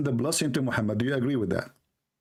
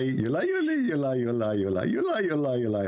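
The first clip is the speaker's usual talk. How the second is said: taunting repetition, dropping lower as it goes; mocking echo; 4 semitones lower than usual. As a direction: chant it over and over in a mimicking loop. Mockingly chants back opponent's words.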